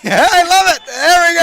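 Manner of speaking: squealing high and loud through laughter; cracking up at the name; laughing delight. Real, not acted. Commentator laughing loudly at his co-host's try.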